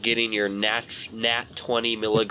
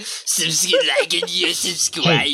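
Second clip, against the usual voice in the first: goblin voice; 8 semitones higher, 3 dB louder, slower than usual. Pretend. Imitating goblin outro.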